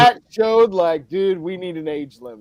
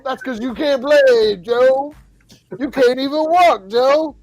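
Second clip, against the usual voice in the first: higher and louder mock candidate jab; mock debate jab; 9 semitones higher, 8 dB louder than usual. Acting out one candidate taunting Donald, higher and louder. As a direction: needle your rival in a raised mocking voice.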